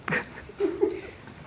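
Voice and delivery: unvoiced high giggle; breathy laugh. Real, not acted. Breaks up reading hate mail.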